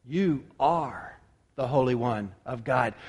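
low, hushed and deliberate; Peter's quiet confession. Acted. Peter answers Jesus with a simple confession, quiet and low. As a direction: say it softly and low, with spaces between phrases. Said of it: "the way I see what Peter says is".

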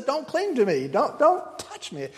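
a hammed-up cold brush-off; mock harsh rebuff. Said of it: "He didn't say it that way".

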